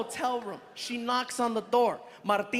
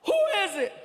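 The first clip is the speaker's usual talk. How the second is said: yelled question; 9 semitones higher, 3 dB louder, faster than usual. Retold or imitated. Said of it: "He yells".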